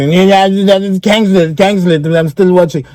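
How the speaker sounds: high, slow mock voice of a glued-in viewer; mock hooked viewer. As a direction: lampoon someone who can't stop watching. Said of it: "What do I look like".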